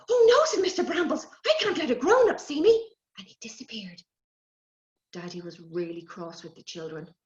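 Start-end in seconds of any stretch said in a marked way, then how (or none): 0.1-2.9 much higher, lightly voiced sprite; a little magic creature, kind but wise; small fairy voice; hedge sprite. Sprite alarmed.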